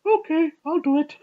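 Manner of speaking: high reluctant ape voice giving in; hammed-up monkey resignation; chimp voice. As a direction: squeak it like a cornered chimp agreeing. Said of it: "And then he goes".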